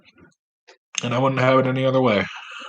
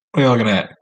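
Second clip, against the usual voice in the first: tough guy challenge; 4 dB louder, much faster than usual. Pretend. Challenging his cat.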